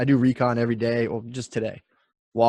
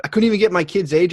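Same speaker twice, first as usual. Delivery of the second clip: ordinary talk, nothing marked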